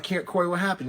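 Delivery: surprised concern. Retold or imitated. Teller sees his friend Corey on a cane, surprised. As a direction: react like you just saw the cane.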